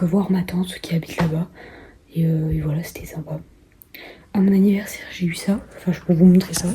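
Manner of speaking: hushed late-night whisper. Real, not acted. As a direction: keep it a quiet whisper.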